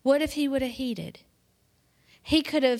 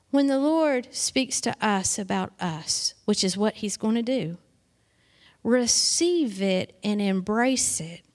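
usual speaking voice

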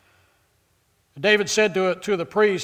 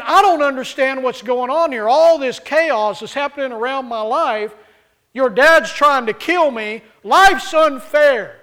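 distressed David; 6 semitones higher, 7 dB louder than usual. Acted. Preacher voices distressed David.

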